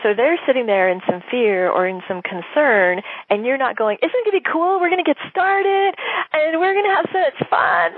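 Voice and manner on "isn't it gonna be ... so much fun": much higher, gushing mock excitement; mock overeager cheer. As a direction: gush like a pushy seller ignoring their fear. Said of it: "you're not a mismatch energetically".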